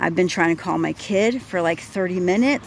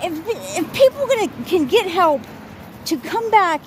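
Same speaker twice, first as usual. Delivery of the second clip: agitated inner voice; 10 semitones higher, 3 dB louder than usual. Retold or imitated. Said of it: "thinking to myself".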